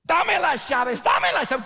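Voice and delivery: shouted Spanish demand for keys; high, loud mock robber yelling; robber's demand. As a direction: bark it like an armed robber.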